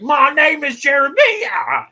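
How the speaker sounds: high, slow, hammed-up British voice; mock the actor's bad British accent; sneering parody of a British accent. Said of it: "It's an awful accent".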